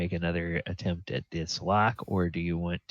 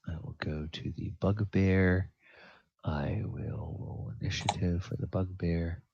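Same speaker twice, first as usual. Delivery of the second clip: whispered aside; 7 dB quieter than usual. Real, not acted. GM muttering his own action.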